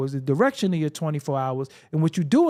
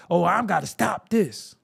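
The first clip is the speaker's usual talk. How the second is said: ordinary delivery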